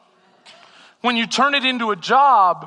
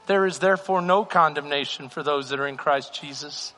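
soft, low pastoral prayer; closing prayer; 6 semitones lower, 3 dB quieter than usual. Acted. Pastor praying over raised hands, soft and low. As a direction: pray over them gently.

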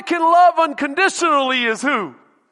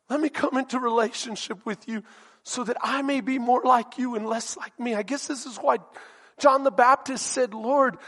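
hushed prayer; 5 semitones lower, 5 dB quieter than usual. Real, not acted. Quiet prayer to God.

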